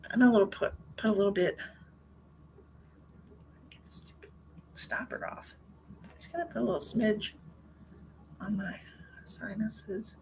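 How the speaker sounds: muttering to herself. Real, not acted. Muttering while opening bottle.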